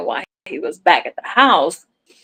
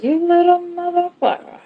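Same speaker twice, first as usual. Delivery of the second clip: fond mock scolding; 9 semitones higher than usual. Scolding the mischievous cat fondly.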